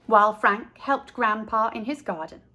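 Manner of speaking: usual speaking voice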